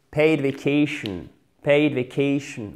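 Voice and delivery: "Paid" with a clear ay diphthong, slowly; diphthong model. Shown as a demonstration. Teacher reminding learners of the diphthong, slow model. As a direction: glide the vowel in "Paid vacation". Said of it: "Notre diphtongue".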